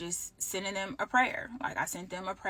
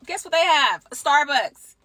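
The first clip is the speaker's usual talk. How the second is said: much higher and louder; exasperated exclamation; 8 semitones higher, 13 dB louder than usual. Real, not acted. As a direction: blurt out the reveal in exasperation. Exasperated reveal of resort's Starbucks.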